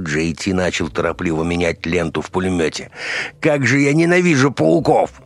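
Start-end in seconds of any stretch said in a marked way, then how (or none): 3.4-5.2 high, loud cry of loathing; exasperated, raised voice; exasperated outburst. A narrator's reading. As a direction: blurt it out in high-pitched disgust. Acted outburst at spiders in battle.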